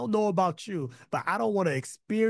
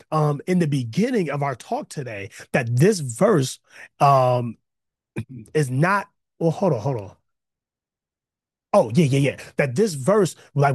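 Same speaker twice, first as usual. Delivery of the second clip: loud, rising preacher's cry; full-force preaching; 3 semitones higher, 7 dB louder than usual. Acted. Pastor preaching hard to his online congregation, loud and fervent. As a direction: drive every line home to the church.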